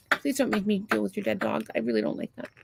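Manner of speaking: ordinary delivery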